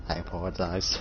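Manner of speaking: low, soft formal apology; bow and say it softly, low; solemn apology. Acted. Roku bows his head and apologizes, soft and low. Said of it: "And he bows his head".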